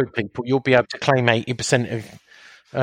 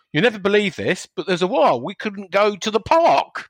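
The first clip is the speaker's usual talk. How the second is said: raised pitch, telling the grandkids a tall tale; storyteller to grandkids; 7 semitones higher than usual. Retold or imitated. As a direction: spin it like an old tale for the little ones.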